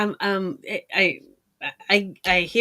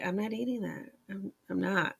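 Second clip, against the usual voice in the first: put-on softer, stubborn child refusing food; quiet refusal; 11 dB quieter than usual. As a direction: murmur it, digging your heels in. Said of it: "I would sit there and I would be like".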